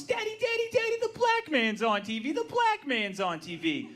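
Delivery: put-on high child's voice calling daddy; daughter calling. Daughter calling daddy from the TV, high child's voice. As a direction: call out like a little girl. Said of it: "She starts screaming at the top of her lungs".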